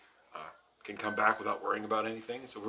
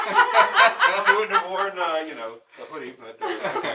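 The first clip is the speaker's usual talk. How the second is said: laughing; 9 semitones higher, 12 dB louder, slower than usual. Real, not acted. Caught live in a hoodie.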